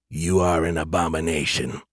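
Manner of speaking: low-pitched mechanical monotone; robotic voice. A narrator's reading. Elder AI condemns Skippy, robotic. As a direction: deliver the verdict like a machine, deep and even. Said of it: "the elder A I we called Echo repeated".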